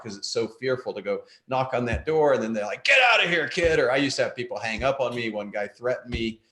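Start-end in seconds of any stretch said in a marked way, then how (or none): 2.8-3.8 loud, higher, dismissive shout; gruff shout. Retold or imitated. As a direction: bark him off the doorstep. Rude prospect slams door.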